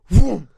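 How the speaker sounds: loud mock vroom noise; a sudden loud vroom as it rips out; ripping sound effect. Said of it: "like, just went".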